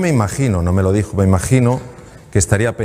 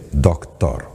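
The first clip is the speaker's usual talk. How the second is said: lower, firmer "Doctor"; changed tone; 5 semitones lower than usual. Retold or imitated. Patient turns firm with her doctor, lower stern tone. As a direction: drop the voice, a warning note. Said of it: "cambia el tono".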